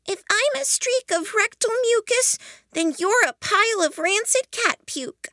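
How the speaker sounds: high, indignant character voice; indignant retort. A narrator's reading. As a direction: fling the insult straight back. Insult thrown back by other character.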